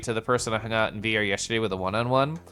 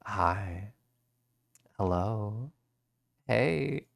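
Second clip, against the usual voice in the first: soft intimate hi; 2 semitones lower, 6 dB quieter, much slower than usual. Shown as a demonstration. Greeting someone close in VR, soft and intimate. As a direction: softly, close and hushed. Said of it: "I just wanna say".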